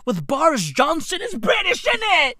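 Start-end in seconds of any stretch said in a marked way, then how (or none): 1.2-2.3 higher-pitched British catchphrase; building mock British. Comic capping a Boris Johnson bit, mock-British exclaiming. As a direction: lift the British punchline up high.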